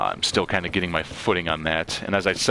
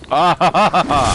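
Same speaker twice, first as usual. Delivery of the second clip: big burst of nervous laughter; loud laugh; 9 semitones higher, 9 dB louder than usual. Real, not acted. Loud reaction in game.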